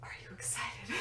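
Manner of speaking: whispered teaser; hushed, teasing whisper to the listeners; conspiratorial whisper. Pretend. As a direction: whisper it to build suspense. Hushed teaser after dramatic pause.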